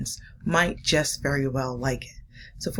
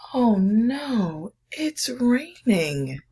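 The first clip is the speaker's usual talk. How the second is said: higher, louder, slow wail of oh no; dog whining at the rain, higher and louder; dismayed dog; 7 semitones higher, 4 dB louder, much slower than usual. Acted. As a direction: moan it like a dog stuck indoors. Cat's dismayed line in story.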